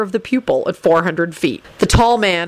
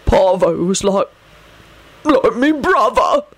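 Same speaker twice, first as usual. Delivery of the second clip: halting, slow grief in a put-on voice; choked up; 2 semitones higher than usual. A narrator's reading. Bald man mourns his friend, choking up. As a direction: choke it out, pitch climbing. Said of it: "he choked out".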